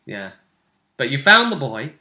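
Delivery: normal talking voice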